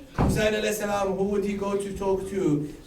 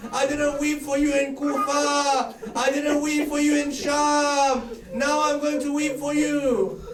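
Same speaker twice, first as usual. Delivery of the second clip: grieving recitation; 7 semitones higher than usual. Acted. Preacher voicing Zainab's grief, wailing. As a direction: lament aloud, stretching words.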